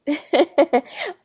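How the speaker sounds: loud, high laugh breaking toward a sob; laughing through real tears; tearful laugh. Real, not acted. Apologizing to viewers for crying, a loud tearful laugh. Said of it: "I was not trying to get emotional".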